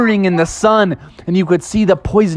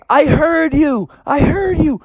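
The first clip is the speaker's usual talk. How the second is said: Eddie the Eagle; 10 semitones higher than usual. Acted. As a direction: answer as a swooping eagle, voice raised high. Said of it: "Eddie the Eagle swooped down and said".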